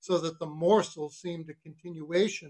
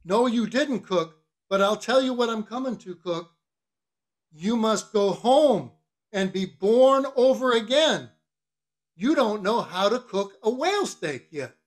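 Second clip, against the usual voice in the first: higher, emphatic mock-grave lecture; scolding mate; 7 semitones higher, 7 dB louder than usual. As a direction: pronounce sentence on the cook. Stubb voiced, lecturing the cook.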